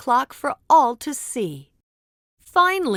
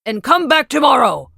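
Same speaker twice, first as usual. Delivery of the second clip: much louder booming wizard shout; angry shout; 9 dB louder than usual. Acted. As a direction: boom it angrily at them. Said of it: "the wizard shouted angrily".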